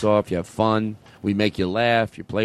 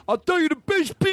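over-the-top Italian; Italian accent. Acted. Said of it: "typical Italian voice".

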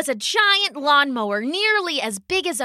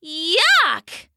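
higher, drawn-out cry of disgust; disgusted exclamation; 5 semitones higher, 4 dB louder than usual. A narrator's reading. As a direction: spit it out in disgust. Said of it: "spitting and coughing".

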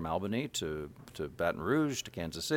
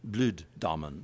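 slow South African "Bloed Diamond" with an oo vowel; repeating the South African "Bloed Diamond" slowly; South African accent; 4 semitones lower, slower than usual. Shown as a demonstration. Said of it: "it comes out correctly as".

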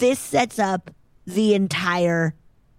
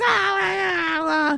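very high, jolting mock yelps; electrocuted cries; 11 semitones higher than usual. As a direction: yelp like you're being shocked. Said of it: "He's like".

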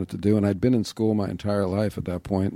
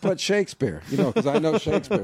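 amused; 6 semitones higher than usual. Acted. Acting out exam filibuster while laughing.

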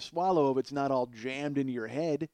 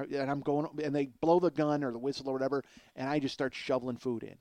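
usual speaking voice

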